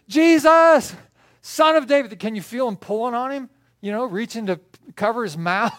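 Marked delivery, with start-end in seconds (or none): 0.1-2.2 very high, full-force shout; yelling even louder. Acted. Blind man shouts over his friends, louder yell. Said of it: "the louder he gets".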